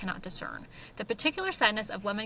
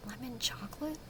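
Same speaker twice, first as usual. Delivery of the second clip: a soft, breathy whispered question; whispered aside; 2 semitones higher, 10 dB quieter than usual. Real, not acted. Host reading the blurb whispers a doubtful aside. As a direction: murmur it to yourself in disbelief.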